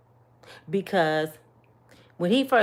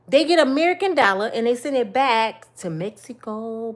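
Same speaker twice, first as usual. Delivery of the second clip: ordinary delivery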